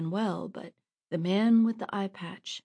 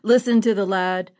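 a rough man jeering loudly; second bandit taunting, louder; mocking outlaw; 6 dB louder than usual. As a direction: laugh down at the boy's boasting. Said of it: "Another man said".

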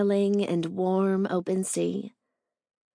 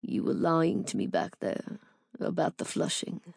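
low, soft teasing reproach; mock disapproval; 3 semitones lower, 5 dB quieter than usual. A narrator's reading. Owen playfully scolding the narrator in mock disapproval. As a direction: scold her playfully, softly.

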